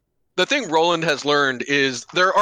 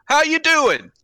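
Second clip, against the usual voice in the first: friendly greeting; 12 semitones higher, 4 dB louder, slower than usual. Retold or imitated. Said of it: "he's just gonna be like".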